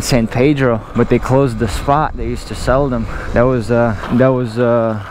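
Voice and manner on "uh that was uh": ordinary talk, nothing marked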